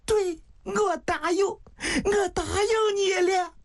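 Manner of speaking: high, crying assent; tearful. Acted. Woman accepting the dying man's proposal, high and tearful. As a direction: promise it through tears. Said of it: "香玉抹着眼泪".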